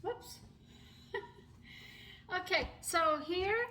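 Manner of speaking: ordinary talk, nothing marked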